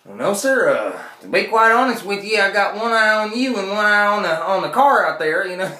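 lightly voiced Texas clerk; nervous clerk. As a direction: keep one eye on him, nervously. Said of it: "clerk replying".

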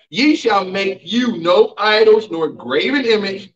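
slow preached scripture reading with each phrase spaced out; read slowly. Shown as a demonstration. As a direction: read it nice and slow so the church can follow. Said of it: "let's read this nice and slow".

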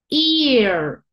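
loud, stretched "Ear", eee-r; long E in ear. Shown as a demonstration. Teacher demonstrating the long sound, stretched "Ear".

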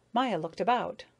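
usual speaking voice

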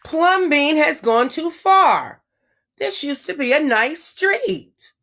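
huffy neighbors clutching pearls; indignant neighbors. A narrator's reading. Neighbors grumbling to each other, indignant. Neighbors complain about the house.

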